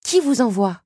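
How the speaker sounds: high, loud interrogation; challenging question, voice lifted; demanding. A narrator's reading. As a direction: demand an answer. Belle challenges the strange beetle.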